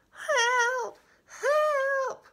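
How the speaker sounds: very high, loud drawn-out squeal; acted high-pitched distress call; crying for help. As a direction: squeal for rescue, stretching each cry. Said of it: "said Octopus".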